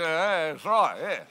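closed-mouth outback Australian. Shown as a demonstration. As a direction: keep the flies out, Australian. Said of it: "So you just say".

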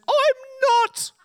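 put-on very high squeaky old-man voice; old man protesting. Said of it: "And the old man says".

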